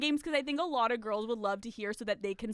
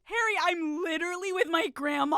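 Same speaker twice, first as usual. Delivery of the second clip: higher-pitched pleading protest; imitating exasperated girlfriend; 5 semitones higher than usual. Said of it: "She's like".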